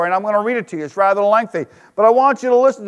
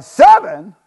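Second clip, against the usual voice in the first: louder, higher incredulous reply; a teacher's scoffing correction; Jesus replying; 4 semitones higher, 5 dB louder than usual. Acted. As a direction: scoff at the small number, then top it. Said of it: "Jesus replied".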